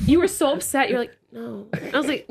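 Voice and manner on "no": half-asleep refusal, barely audible; groggy mumbled no. Retold or imitated. Imitating the half-asleep friend refusing to get up, groggy and quiet. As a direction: mumble it like you're still asleep.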